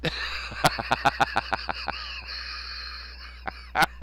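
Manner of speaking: long breathless cackle trailing into wheezes; wheezing laugh. Real, not acted. Host cracking up at his accent, wheezing laugh. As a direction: laugh until the air runs out.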